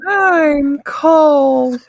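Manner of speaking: put-on high eerie ghost-child whine; ghost kid voice.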